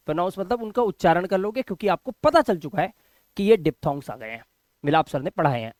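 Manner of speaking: ordinary talk, nothing marked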